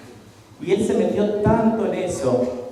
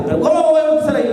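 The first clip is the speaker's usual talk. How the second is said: high, fast, alarmed objection; incredulous protest; 11 semitones higher, much faster than usual. Retold or imitated. The man protesting to the spirit, rushed and high. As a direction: blurt it out high and fast in disbelief.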